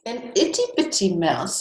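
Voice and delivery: ordinary delivery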